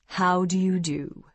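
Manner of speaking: slow, austere formal greeting; slow and stately, very proper. Acted. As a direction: greet them with stiff, measured formality. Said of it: "Michael nodded austerely".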